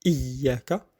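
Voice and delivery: Iyyaka with a full i and held y; clear initial i. Shown as a demonstration. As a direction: sound the i of Iyyaka fully. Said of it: "il faut bien le prononcer".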